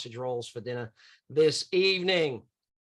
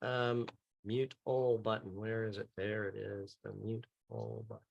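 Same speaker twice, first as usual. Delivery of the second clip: under your breath, trailing away softer; absent self-talk; 7 semitones lower, 9 dB quieter than usual. Real, not acted. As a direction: talk yourself through finding a button, very quietly. Searching for mute button to himself.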